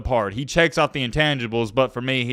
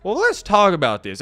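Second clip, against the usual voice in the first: normal talking voice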